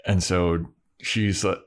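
ordinary delivery

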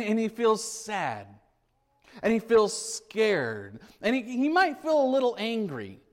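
ordinary delivery

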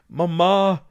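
high, loud cries rising sharply; boy crying out to his mother from the window; calling out. A narrator's reading. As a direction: scream for mamma in terror. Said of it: "ropte Sverre Albert".

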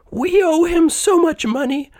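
very high-pitched woman character; worried wife. A narrator's reading. Wife discusses their debt with husband, very high character voice. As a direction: pitch it far up as the debtor's wife. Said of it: "the lady said".

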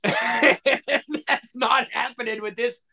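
laughing through the words; amused disbelief. Retold or imitated. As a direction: laugh it off incredulously. Laughs while retelling his thought.

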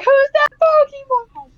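put-on high, slow shouting child voice; excited kids. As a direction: squeal it like kids watching cartoons. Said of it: "they're like".

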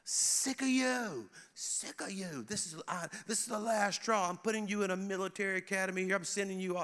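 high, strained mock tirade; berating father. Dad tearing into his son at police scene, mock berating. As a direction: imitate a fed-up dad's high-pitched scolding. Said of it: "berating his, his son".

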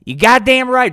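loud, high puppet-show patriot; high, loud blowhard voice; Hank. Acted. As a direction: belt it out like a fired-up podcast host. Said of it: "That's Hank".

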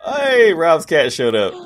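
high, loud delighted call; light up at the cat; excited. Real, not acted. Sudden cat appears on call.

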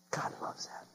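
barely audible whisper; hushed, voiceless repeat. Real, not acted. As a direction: whisper it to the congregation.

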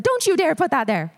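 high, sharp mock scolding; mega scold. [name] catching her at the gum, mock mega scold. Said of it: "Like, mega scold".

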